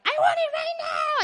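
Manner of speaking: put-on high, loud toddler shout; preacher acting out a toddler demanding a cookie, shouting. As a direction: yell it like a two-year-old grabbing a cookie. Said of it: "two-year-old will be like".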